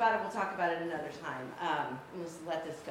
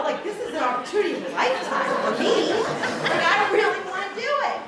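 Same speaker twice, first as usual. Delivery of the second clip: high, loud, laughing insistence; laughing plea; 12 semitones higher, 13 dB louder than usual. Retold or imitated. Millie wanting the circus job, high and laughing. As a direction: beg for the dream through laughter.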